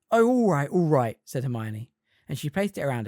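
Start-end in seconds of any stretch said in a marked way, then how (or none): 0.1-1.2 reluctant giving in. A narrator's reading. Hermione giving in.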